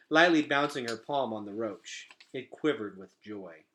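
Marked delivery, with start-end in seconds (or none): none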